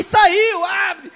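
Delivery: high, loud demand; urgent shout. Retold or imitated. Pastor yells at the neighbor to open the door, high and loud. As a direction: bark it to get out fast.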